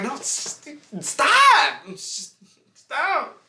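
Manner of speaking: high-pitched mock whine of stop; squeaky high protest. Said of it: "I'm like".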